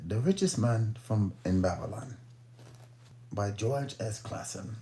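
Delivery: hammed-up British announcing; British accent. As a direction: read the title in a mock-British voice. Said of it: "do my little British accent".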